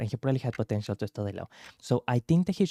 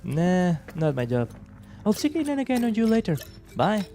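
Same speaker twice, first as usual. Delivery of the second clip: dismissive voice; 6 semitones higher than usual. Acted. Dismissive character.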